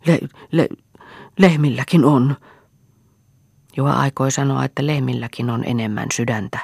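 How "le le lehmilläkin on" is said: stammering, voice catching, higher; can't get the words out for emotion; choked with emotion. Acted. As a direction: son too moved to finish his reproach. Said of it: "ei saanut sitä liikutukseltaan sanotuksi".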